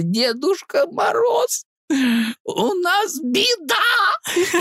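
very high, very slow wail through laughter; laughing fake sob. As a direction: wail the news, cracking up. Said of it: "И я тут, знаешь, просто раскалываюсь".

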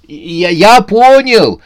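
high, loud, stammering cry; shouted on the run. A narrator's reading. Новый год answers pursuers on the run, crying out. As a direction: blurt it back mid-chase. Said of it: "отвечал на бегу".

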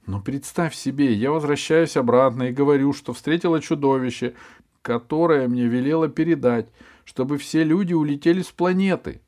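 rising, eager and insistent; excited girl. Acted. Alice explains excitedly.

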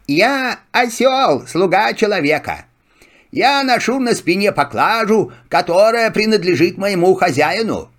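humble servant donkey. Acted. Donkey speaks. (голос осла)

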